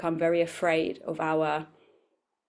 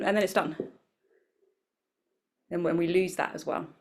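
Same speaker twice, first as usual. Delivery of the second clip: normal talking voice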